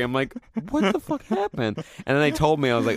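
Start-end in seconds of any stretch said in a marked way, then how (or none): 0.7-1.8 comically high, angry just-woke-up voice; angry on waking. Retold or imitated. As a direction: act out waking up indignant. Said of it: "I always wake up, like, angry".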